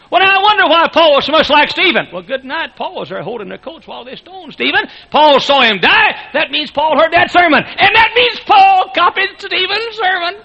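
high, loud, rising preacher's cry; preaching at full force. Real, not acted. Preacher to congregation, building to a loud climax. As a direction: build the revelation to a peak.

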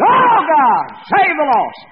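screamed high and loud, full force; preaching shout. Retold or imitated. Preacher roaring from the pulpit at a revival crowd, shouting. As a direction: cry out to God at the top of your lungs. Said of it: "I was really preaching ... and I said".

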